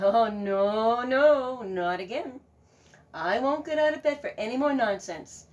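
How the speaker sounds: loud, fed-up farmer; grumpy refusal. Acted. Farmer refusing the goose from bed, grumpy. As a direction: grumble it from bed.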